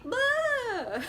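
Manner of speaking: high, laughing "blah" of boredom; mock dismissive blah. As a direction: toss off a bored, giggly blah. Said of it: "she's like".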